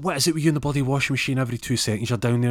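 fast, high, loud scolding; acted exasperation. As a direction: rattle off an irritated complaint at a husband. Wife quarrels about washing machine.

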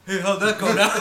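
loud, laughing mock shout; hammed-up, very loud, half laughing; mock Batman call-out. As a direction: call out like an oblivious hero. Mocking Batman calling out.

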